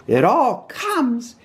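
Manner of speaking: high, slow, drawn-out scolding; stern Rabbit. A narrator's reading. Rabbit lecturing stuck Pooh, slow and stern. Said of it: "said Rabbit sternly".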